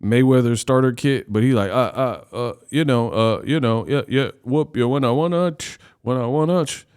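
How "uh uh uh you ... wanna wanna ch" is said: choppy repeats of "uh", "you know" and "wanna"; mock stutter. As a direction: imitate someone stuck on "uh, uh, you know". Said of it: "Devin stutter sounds bad".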